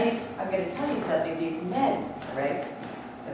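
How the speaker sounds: dropping to a low hush about the men; confiding hush. Acted. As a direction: tell it like a naughty secret.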